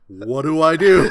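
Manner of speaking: high, loud, laughing helplessness; a lost worker pleading to his boss; mock panic. Worker texting his boss Bob about the missing booth, in mock panic.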